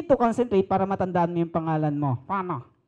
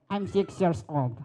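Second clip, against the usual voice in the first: put-on small, slow, soft little boy voice; soft, slow kid's voice for the puppet Junior; child puppet voice; 6 semitones lower, 6 dB quieter, slower than usual. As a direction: speak as a timid six-year-old puppet.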